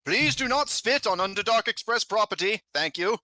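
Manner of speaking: high-pitched train announcement; high, formal automated-style announcer; gramophone announcer. Acted. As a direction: scold politely like a train tannoy. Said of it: "voice kinda come over the gramophone".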